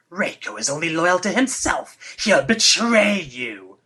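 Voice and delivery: lightly unhinged villain, drawn out at the end; crazy voice. Acted. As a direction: read it like a slightly unhinged person. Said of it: "trying to read in a crazy voice".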